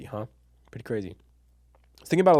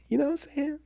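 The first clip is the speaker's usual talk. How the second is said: high squeaky aside; 13 semitones higher, 4 dB quieter than usual. Real, not acted. Excited aside, voice jumps high.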